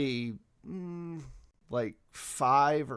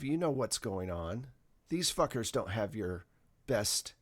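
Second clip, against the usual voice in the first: ordinary talk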